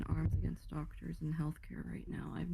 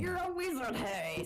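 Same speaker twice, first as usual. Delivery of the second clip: higher, hammed-up movie quote; Hagrid quote; 7 semitones higher than usual. Friend riffing on doctors as wizards, hammed-up Hagrid. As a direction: proclaim it like the famous film line. Quoting Hagrid's famous film line.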